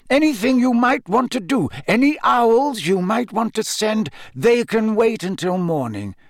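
high, insistent instructions; stern, commanding headmaster; Dumbledore, firm. A narrator's reading. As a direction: lay down the law urgently. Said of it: "Dumbledore repeated firmly".

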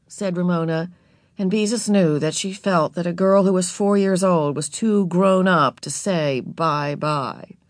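ordinary delivery, nothing marked

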